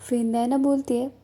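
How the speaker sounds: ordinary delivery, nothing marked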